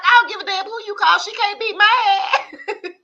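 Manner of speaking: high, loud laughing impression of a fed-up mother; hammed-up defiant mama; mock angry mom. Son imitates mom telling husband off, high loud laughing mock. Mom voiced in retold argument.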